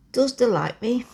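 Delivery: put-on a girl's try at broad Yorkshire; Yorkshire accent. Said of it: "Trying to speak in a warm Yorkshire voice".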